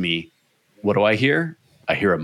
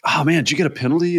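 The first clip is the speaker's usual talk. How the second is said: high, fast fake concern; faux-concerned rider; mock sympathy; 8 semitones higher, much faster than usual. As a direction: sound innocent while gloating. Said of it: "I was like".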